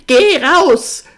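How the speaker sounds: loud, high furious order; furious snarl. A narrator's reading. Sister throwing the visitor out, furious snarl. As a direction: snap it out at full volume. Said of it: "fauchte ihn an".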